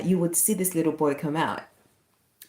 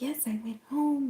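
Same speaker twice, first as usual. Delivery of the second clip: gentle confirmation; 8 semitones higher, 5 dB quieter than usual. Retold or imitated. Retold sharing news with frail man.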